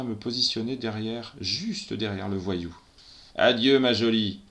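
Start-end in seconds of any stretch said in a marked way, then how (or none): 3.4-4.4 louder, sneering farewell; grating sneer. A narrator's reading. Gunman sneers goodbye to the policewoman. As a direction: grind it out before pulling the trigger. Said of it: "grince ce dernier".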